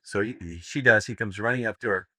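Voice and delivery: usual speaking voice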